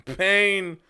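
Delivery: loud, high cry of pain; mock pained cry. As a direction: shout it high, hamming up the agony. Exclaims after the shriek.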